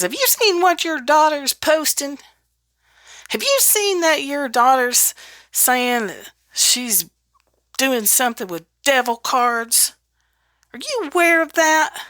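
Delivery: scandalized aunt. Retold or imitated. Aunt Myrtle calling mama about the daughter, high and scandalized. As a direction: play the shocked busybody aunt. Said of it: "and says".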